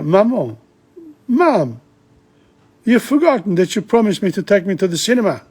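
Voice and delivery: put-on high, quick child's nagging; child Claire. Claire reminding her mother about the cinema, high and fast.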